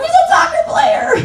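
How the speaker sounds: high, loud proud exclamation with a laugh; excited parent. Pretend. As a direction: cheer it like a thrilled parent. Parent excited about child's hobby.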